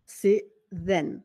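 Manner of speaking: ordinary delivery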